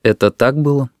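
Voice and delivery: restrained, measured question. A narrator's reading. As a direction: hold it back as you ask. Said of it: "очень сдержанно спросил". (очень сдержанный вопрос)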